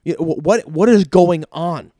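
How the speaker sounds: stammered, then slow emphatic demand; sputter, then drag out the question; exasperated. Retold or imitated. Dentist demanding an explanation from patient, exasperated.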